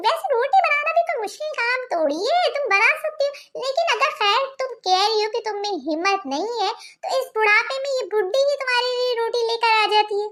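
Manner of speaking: guilt-trip her with mock frailty; sarcastic old aunty.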